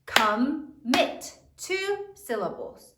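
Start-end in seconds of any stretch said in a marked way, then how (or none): 0.0-1.4 "Commit" as two separate beats, com, mit; syllable by syllable. Shown as a demonstration. Teacher counts syllables for the class, "Commit" in two separate beats. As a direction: split "Commit" with a clear gap. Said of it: "How many syllables are there".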